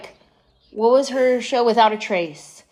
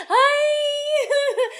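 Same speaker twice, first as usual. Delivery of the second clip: very high, laughing "Hi.". Retold or imitated. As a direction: chirp "Hi." way up through a laugh. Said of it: "I can only laugh like her and say hi".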